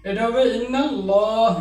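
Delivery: ইন্নাল্লাহ spoken with the lam heavy, as it should be; correct heavy lam. Shown as a demonstration. Teacher showing students the right heavy-lam ইন্নাল্লাহ. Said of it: "এটা লা হবে না".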